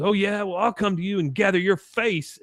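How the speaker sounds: high-pitched combative comeback; mock threat. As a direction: act out squaring up for a fight. Acted threat in quarrel.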